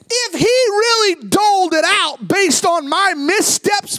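loud, high preaching cry; preaching at full force. Acted. Pastor warning his church what they really deserve, shouting. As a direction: thunder it at the congregation.

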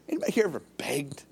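quick, hushed question to the room; whispered aside. Real, not acted. Pastor asking his congregation a quick whispered question. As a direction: drop to a whisper and ask fast.

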